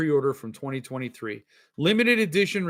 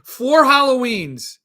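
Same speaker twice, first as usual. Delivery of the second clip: exasperated; 8 semitones higher, 7 dB louder, slower than usual. Real, not acted. Reading stalled order status aloud.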